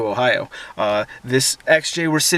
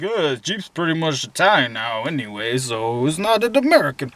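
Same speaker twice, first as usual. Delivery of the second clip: lightly voiced clueless asker; mock dumb questioner. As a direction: vlogger mimics a clueless viewer's question, mild mock dumb voice. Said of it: "dumb questions like".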